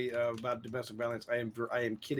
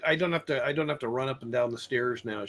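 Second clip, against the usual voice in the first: ordinary talk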